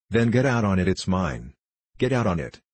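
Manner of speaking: ordinary delivery, nothing marked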